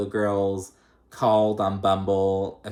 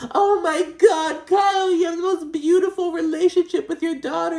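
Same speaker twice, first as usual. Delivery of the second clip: mock gushing Dorit; 6 dB louder than usual. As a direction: shriek it like an over-the-top fan. Mocking Dorit gushing to Kyle.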